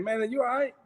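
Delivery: like checking on someone not making sense; concerned disbelief. Retold or imitated. Brother checking on the confused runaway, high and puzzled.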